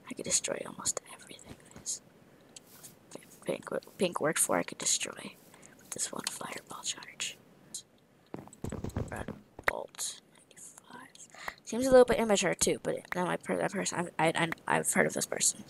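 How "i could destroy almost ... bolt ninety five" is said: fast whispered plotting. Real, not acted. Gamer whispering his plan to destroy everything. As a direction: scheme quietly into the mic.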